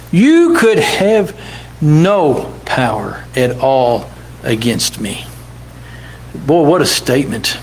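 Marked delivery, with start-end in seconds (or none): 0.1-5.2 drawn out, falling on NO; slow emphatic repeat. Shown as a demonstration. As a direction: lean on "no power" slowly. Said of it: "preacher repeats the line slowly".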